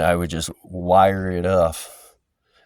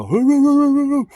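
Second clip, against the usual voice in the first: shrill, loud hoo hoo to scare the dog off; high hooting call; 10 dB louder than usual. Retold or imitated. Calling across the yard at the neighbor's dog, loud high hoots. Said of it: "and I'll go".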